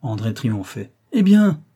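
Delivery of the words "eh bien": much higher, louder cry of triumph; high ringing "eh bien" of victory; triumphant exclamation. A narrator's reading. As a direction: crow it out, you've won the point. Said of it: "s'écria-t-il".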